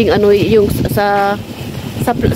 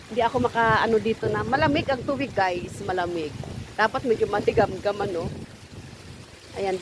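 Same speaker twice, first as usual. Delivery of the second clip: much softer, half under breath; hushed; 13 dB quieter than usual. Real, not acted. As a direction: keep it down so nobody hears. Hushed so lola won't see.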